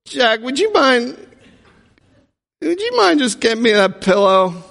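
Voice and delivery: high, sugary plea; high sweet-talking request; sweet asking favor. Retold or imitated. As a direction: coax a favor out of him. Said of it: "You say".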